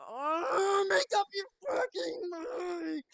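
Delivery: high, loud, drawn-out wail of frustration; exasperated outburst. Real, not acted. Streamer fed up with her indecision, exasperated yell. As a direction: shout it slowly, high and fed up. Streamer erupts at the game character.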